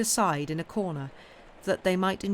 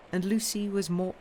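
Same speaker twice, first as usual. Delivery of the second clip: ordinary delivery, nothing marked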